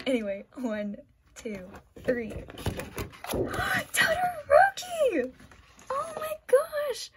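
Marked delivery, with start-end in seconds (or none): 2.3-3.9 high, sharp gasp of delight; shocked gasp. Real, not acted. Flips over the final print. 3.9-7.1 very high, drawn-out shriek; piercing, stretched-out excitement; ecstatic fangirl squeal. Real, not acted. Favourite character revealed on print.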